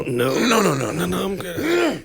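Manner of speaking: pretending not to be groggy; fake awake. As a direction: insist you're wide awake. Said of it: "And you go".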